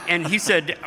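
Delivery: ordinary talk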